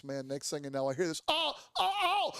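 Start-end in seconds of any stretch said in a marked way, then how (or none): 1.3-2.3 high-pitched mock "oh" yelps; imitated crash cries. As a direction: imitate a rider yelping as he falls. Acted cries of a crashing rider.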